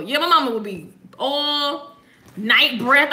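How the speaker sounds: usual speaking voice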